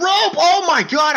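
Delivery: high, loud, drawn-out yell; excited shout. Acted. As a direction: roar it as the move lands. Top rope clothesline.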